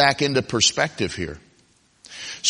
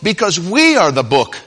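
higher, driving sermon delivery; emphatic preaching; 5 semitones higher, 5 dB louder than usual. Acted. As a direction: preach it with raised pitch and firm emphasis.

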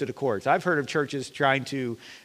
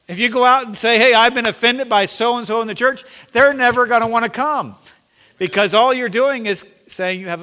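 higher, building preaching toward loud; rising preaching; 8 semitones higher, 8 dB louder than usual. Acted. Pastor warning church about gossip, building preaching. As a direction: climb back up into the sermon.

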